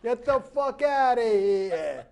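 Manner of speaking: slow hammed-up Brooklyn tough-guy accent, laughing; Brooklyn wiseguy. Mocking a Brooklyn bruiser for a laughing cohost, hammed-up Brooklyn accent. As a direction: stretch it out like a mock Brooklyn mobster. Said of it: "that fucking Brooklyn accent".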